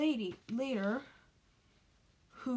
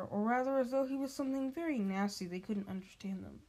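usual speaking voice

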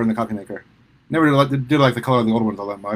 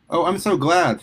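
bright, lifted exclamation of delight; exclaiming; 7 semitones higher, slower than usual. A narrator's reading. Mother delighted by her son's new friends, exclaiming. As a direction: let the joy jump out. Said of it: "Sue exclaimed".

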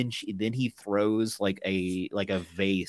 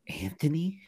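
unvoiced whisper of a name; whispered call; 6 semitones higher than usual. [name] whispering to Anthony in the dark. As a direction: breathe the name out, unsure.

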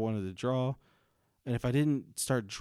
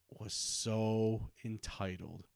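so stretched very slow; drawn-out disgust; much slower than usual. Real, not acted. Complaining about the teacher, slow drawn-out disgust. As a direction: drag out so entitled with disdain. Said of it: "It was disgusting".

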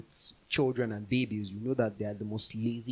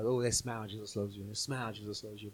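flippant platitude; 3 semitones lower, 5 dB quieter than usual. Retold or imitated. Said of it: "It's just stuff that they're saying".

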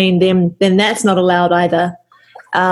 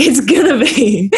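laughing. Real, not acted. Laughing while quoting.

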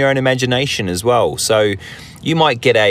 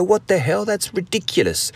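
mock disbelief, pitched much higher; incredulous outburst; 10 semitones higher, 3 dB quieter than usual. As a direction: play the scoffing onlooker, half joking. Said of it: "you'd go".

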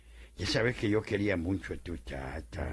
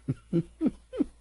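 sly cackle; 14 semitones higher, 3 dB louder than usual. Acted. Trickster laughs at his victim.

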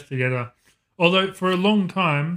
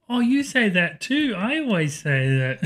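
put-on child's voice, slow; 6 semitones higher than usual. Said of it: "she goes".

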